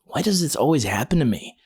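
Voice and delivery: a touch of self-pitying moan. A narrator's reading. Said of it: "John was moaning".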